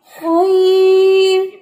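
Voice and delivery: खैर drawn out slowly with a thin light r; light raa. Shown as a demonstration. Teacher models light r in खैर. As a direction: keep the r in खैर light and stretched. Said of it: "ये जो रा है, ये बारीक है".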